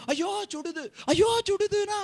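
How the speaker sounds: high-pitched mock wail; mock pained cry. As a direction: yelp as if scalded, hammed up. Acts one complaining of the heat. (சூடு தாங்காதது போல போலியாக அலறுவது)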